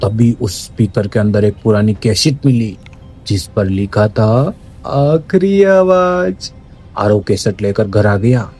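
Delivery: plain reading voice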